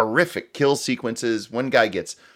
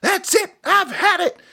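much higher, hammed-up fed-up voice; over-the-top fed-up declaration; mock exasperated quit. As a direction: play the nanny storming off in mock disgust. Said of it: "walking through it and going".

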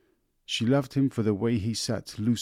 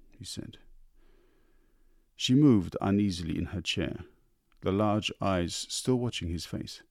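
usual speaking voice

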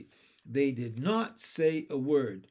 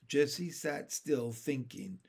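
ordinary delivery, nothing marked

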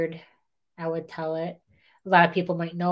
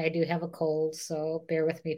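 ordinary talk, nothing marked

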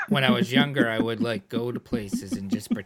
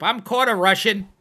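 mock proud claim; 9 semitones higher, 11 dB louder than usual. Mocking Americans claiming Russian heritage.

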